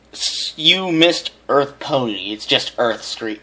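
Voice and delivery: ordinary delivery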